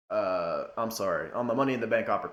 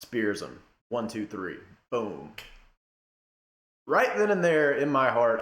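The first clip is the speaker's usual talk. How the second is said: ordinary talk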